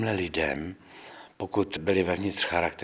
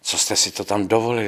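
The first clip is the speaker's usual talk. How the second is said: raised, louder voice imitating an irate official; imitated indignant telling-off, pushed louder; retold agitated outburst; 4 semitones higher, 6 dB louder than usual. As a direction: act out an angry official's demanding questions. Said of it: "který se rozčiloval a říká".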